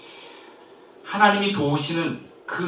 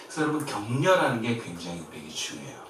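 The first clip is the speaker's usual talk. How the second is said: ordinary delivery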